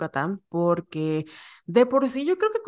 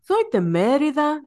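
slow, higher, exaggerated Yucatecan accent on Soy de Mérida; mock a fake overdone Yucatecan accent; forced Yucatecan accent; 8 semitones higher, 3 dB louder, slower than usual. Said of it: "así fuerzan su acento".

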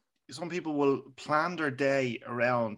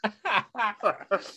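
high laugh; 8 semitones higher than usual. Real, not acted. Laughing at choking friend.

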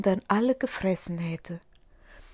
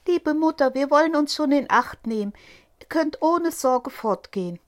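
put-on higher, quick eager kids' voice; little goat kids; 7 semitones higher, 4 dB louder than usual. Goat kids reassure their mother, higher and quick. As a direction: chirp it back like little kids.

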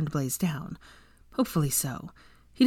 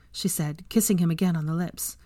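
ordinary delivery

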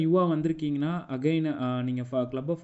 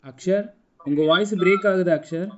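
calling out; 5 semitones higher, 7 dB louder than usual. Real, not acted. Calling to a speaker with a bad line.

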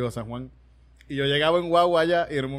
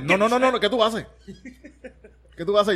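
mock crew brushoff; 5 semitones higher than usual. Crew refusing his help.

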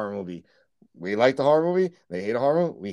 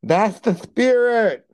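whiny cheerleader; 7 semitones higher, 5 dB louder, slower than usual. Real, not acted. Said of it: "you sound like a whiny little cheerleader".

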